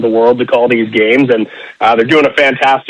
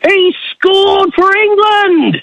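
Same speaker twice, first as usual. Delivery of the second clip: soaring, shouted play-by-play goal call; rising excited soccer commentator yelling go; slower than usual. Shown as a demonstration. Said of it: "give us your best, uh, World Cup of soccer goal announcement".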